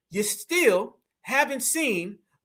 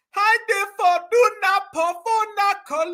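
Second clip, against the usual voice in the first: mock Spanish gibberish; 9 semitones higher than usual. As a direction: chant nonsense in a fake Spanish accent. Mimics the garbled speech just played.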